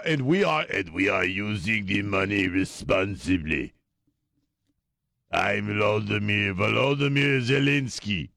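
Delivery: light put-on Ukrainian accent, slowed; lightly voiced Ukrainian leader with accent; Zelensky Ukrainian accent. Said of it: "this is my Ukrainian voice".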